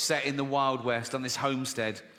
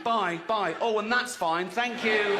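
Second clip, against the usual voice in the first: mock surprise; 6 semitones higher, slower than usual. Acting surprised leaving doctor.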